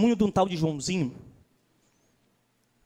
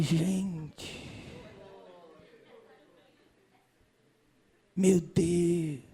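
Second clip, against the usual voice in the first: softer, lower stunned murmur; stunned dismay; 7 semitones lower, 7 dB quieter, much slower than usual. Retold or imitated. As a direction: let it sink in, appalled. Shocked realization retold. (espanto baixinho)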